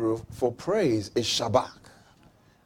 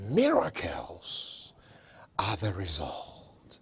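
slow whispered reveal; hushed whisper; 10 semitones lower, 7 dB quieter, slower than usual. Real, not acted. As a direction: drop to a breathy hush on miracles. Preacher drops voice for effect.

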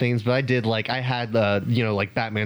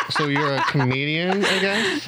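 mock condescending question; 2 semitones higher, slower than usual. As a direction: talk down to them. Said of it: "That was so condescending".

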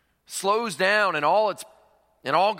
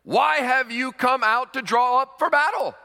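louder, higher taunting call; shouting challenge; 6 semitones higher, 4 dB louder than usual. Retold or imitated. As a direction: call out across the valley. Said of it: "shouted to the ranks of Israel".

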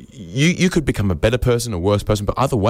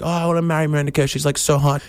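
higher, rushed lovestruck bloke; mock dreamy guy; 6 semitones higher, faster than usual. Every guy online gushing about a celebrity, fast mock dreamy voice. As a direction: gush like a smitten fan.

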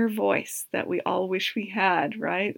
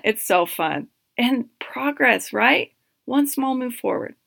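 usual speaking voice

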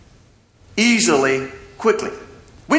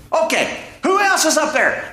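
punchline echo; 10 semitones higher than usual. Retold or imitated. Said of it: "and we say".

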